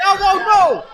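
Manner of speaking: high, loud shocked cry. Real, not acted. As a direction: gasp it out in disbelief. Commentator reacts to big spot.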